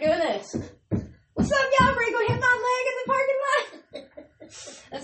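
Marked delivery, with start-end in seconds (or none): 1.3-4.0 mock cheery hustler. Pretending to greet people on the street, high and laughing. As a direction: call it out bright and high.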